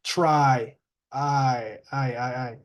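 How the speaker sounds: "Try", then its vowel "I" repeated separately; last-sound demo. Shown as a demonstration. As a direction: isolate the voiced "I" ending of "Try". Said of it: "There is vibration".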